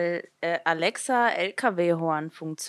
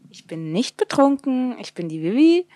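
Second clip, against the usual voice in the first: indignant denial; 6 semitones higher, 5 dB louder than usual. Real, not acted. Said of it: "ist ganz betrunken".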